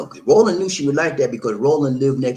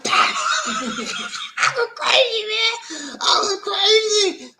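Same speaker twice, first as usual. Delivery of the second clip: high, drawn-out laughing shriek; hysterical laughing; 3 dB louder, much slower than usual. Real, not acted. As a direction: squeal the words through helpless laughter. Cracking up over his crank calls.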